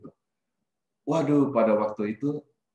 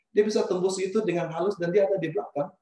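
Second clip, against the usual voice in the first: normal talking voice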